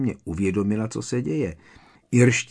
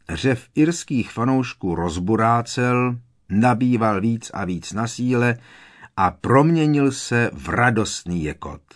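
usual speaking voice

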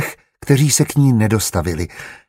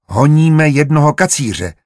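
higher, louder, weighty announcement; meaningful announcement; 6 semitones higher, 5 dB louder than usual. A narrator's reading. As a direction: announce it with significance. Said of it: "oznámil jezuita významně".

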